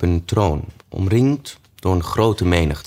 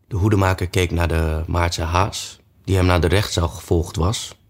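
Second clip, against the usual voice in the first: usual speaking voice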